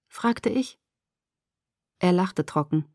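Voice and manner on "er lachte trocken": ordinary delivery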